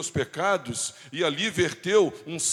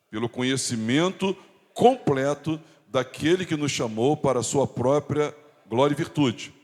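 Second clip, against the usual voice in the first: emphatic, lower-pitched group-leading recitation; congregational scripture reading; 3 semitones lower, 4 dB louder than usual. Acted. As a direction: lead the reading in measured phrases, lifting the ends. Vamos ler juntos?.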